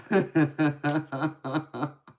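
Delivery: real amused laugh; laughter. Real, not acted. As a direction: laugh out at the chat. Laughing at viewer comment.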